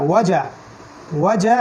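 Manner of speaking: ordinary talk, nothing marked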